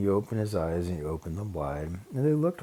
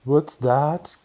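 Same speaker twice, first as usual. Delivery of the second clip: calling up; 6 semitones higher, 8 dB louder than usual. Acted. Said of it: "called a voice from way down".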